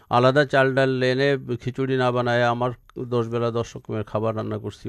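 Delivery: ordinary talk, nothing marked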